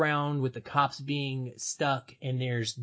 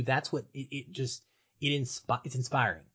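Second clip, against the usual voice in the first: normal talking voice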